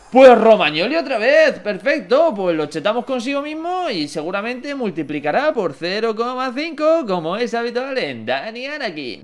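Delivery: loud, high-pitched excited commentary; excited exclamation. Real, not acted. Gamer gets Romagnoli a second time, loud and excited. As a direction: burst out loud, then keep the pitch up. Gets the same player again.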